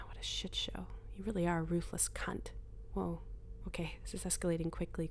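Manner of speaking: hushed inner voice, close to the ear; a voice inside her head, whispering; whispered inner voice. Acted. Inner voice arguing.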